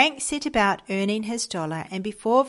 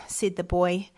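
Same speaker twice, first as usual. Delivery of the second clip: plain reading voice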